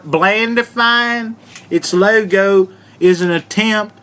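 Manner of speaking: Southern drawl for reading aloud; put-on Southern accent. Host reads the article's first reason, put-on Southern accent. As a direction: read it out like a Southerner, with twang. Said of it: "read this whole article in this voice".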